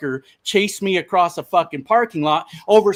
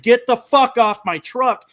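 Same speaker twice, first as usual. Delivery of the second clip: louder, higher angry outburst; retold losing it; 7 semitones higher, 5 dB louder than usual. Driver lunging at man on his step, retold angry shout. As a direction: re-enact snapping at someone to back off. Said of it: "I lost it".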